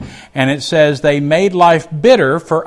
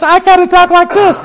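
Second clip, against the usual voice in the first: much higher, louder, squeaky put-on voice; 7 dB louder than usual. Shown as a demonstration. Jacob worrying about faking Esau, in a high put-on voice. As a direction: jump way up into a silly voice to show the disguise. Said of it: "instead of talking like this".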